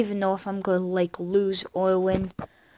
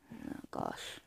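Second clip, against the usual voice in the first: whispering; 18 dB quieter than usual. Real, not acted. Dismayed at game.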